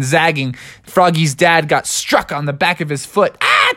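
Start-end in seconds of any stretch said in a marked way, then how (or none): 3.4-3.7 short high yelp of being zapped; acted pain yelp. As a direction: yelp as if jolted. Struck by lightning.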